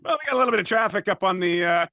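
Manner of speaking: loud, fast traffic report over rotor noise; yelling over helicopter. Retold or imitated. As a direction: shout the traffic report from a chopper. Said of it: "I felt like Les Nessman ... trying to do the weather to make it sound like he's in a helicopter".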